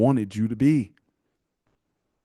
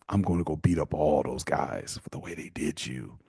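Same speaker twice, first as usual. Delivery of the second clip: low, soft avenging vow; mock tough protector; 7 semitones lower, 8 dB quieter than usual. Boyfriend vows revenge on her exes, mock tough. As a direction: play the protective boyfriend, dropped in pitch and volume.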